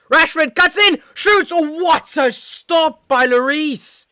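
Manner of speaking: very high, loud shout at a big save; frantic, high-pitched football call; excited match commentary. Real, not acted. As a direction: yell the shot as it happens, then stretch the amazement. Live call of shot and save.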